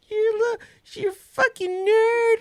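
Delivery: put-on high, slow toddler voice; imitated little daughter. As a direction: mimic a kid trying out an insult. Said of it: "she goes".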